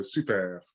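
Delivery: super with a rolling R at the end, no T; rolled R, silent T. Shown as a demonstration. Correcting the learner's French name. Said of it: "a bit more of a rolling R at the end".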